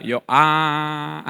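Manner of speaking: ordinary delivery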